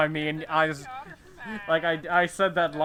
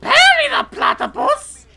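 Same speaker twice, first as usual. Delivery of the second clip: high, shouted cartoon villain voice; Doctor Doofenshmirtz voice; 14 semitones higher, 10 dB louder, slower than usual. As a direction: shriek it like a frantic cartoon scientist. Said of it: "Ah, Doctor Doofenshmirtz!".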